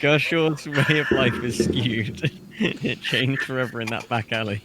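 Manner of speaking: laughing joke. Real, not acted.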